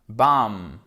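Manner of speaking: BAM ending on a held M, no final B; B final muet. Shown as a demonstration. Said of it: "il se prononce pas". (Bam sans prononcer le B final)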